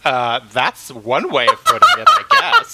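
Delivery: dry remark dissolving into a laugh; deadpan line that cracks up at the end; laughing retort. Retold or imitated. Mocking the chairman's phrasing, laughing through it.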